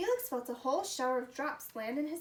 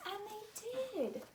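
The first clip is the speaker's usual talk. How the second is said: delighted; 8 semitones higher, slower than usual. A narrator's reading. Delighted reveal.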